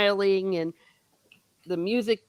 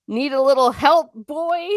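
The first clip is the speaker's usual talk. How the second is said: raised, lightly acted offer of help; teasing rescuer; 7 semitones higher, 5 dB louder than usual. Rescuer surprising a captive with an offer, lightly teasing. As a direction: pitch it up, rising on the last word.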